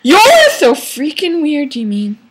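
mock-indignant comeback; snapped retort. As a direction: throw it back with exaggerated annoyance. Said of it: "I snapped".

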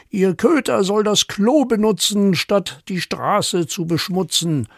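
high, indignant scolding; outraged neighbour. Acted. Neighbour complains about the fouled street, mock fury. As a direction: scold them in hammed-up outrage.

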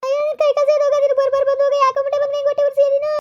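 high, sustained aggrieved wail; wailing lament. Acted. Wife lamenting as her husband scolds her, high wailing. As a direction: cry out a curse in a high lament.

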